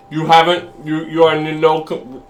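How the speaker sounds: ordinary delivery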